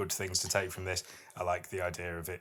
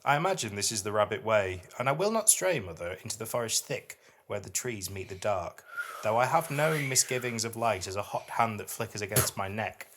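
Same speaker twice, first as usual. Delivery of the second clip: higher, louder, measured poem recital; poetry reading; 3 semitones higher, 3 dB louder than usual. Acted. Podcast host reads a dark fairy-tale poem to her co-host, recited with weight. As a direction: read it like a performed poem, each image weighed.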